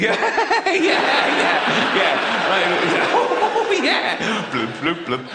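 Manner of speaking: lightly mocked jolly chuckler; jolly fat person. Fat person answering a teasing greeting, mock jolly. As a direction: chuckle along in mock jolliness. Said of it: "They're jolly".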